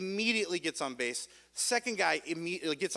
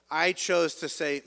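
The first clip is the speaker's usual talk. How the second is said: usual speaking voice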